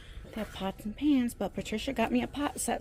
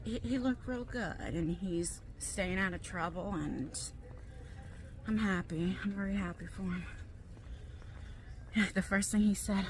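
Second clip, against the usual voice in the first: ordinary talk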